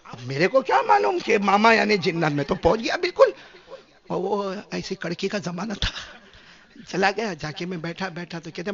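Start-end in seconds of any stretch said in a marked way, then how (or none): none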